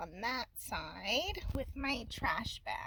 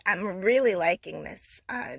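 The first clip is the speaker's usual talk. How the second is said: ordinary delivery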